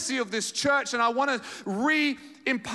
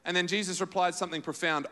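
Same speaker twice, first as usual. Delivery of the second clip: normal talking voice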